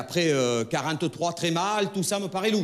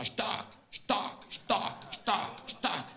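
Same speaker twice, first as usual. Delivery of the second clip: clock tick noise; 5 semitones higher, 7 dB quieter, much slower than usual. Retold or imitated. Clock ticking imitation.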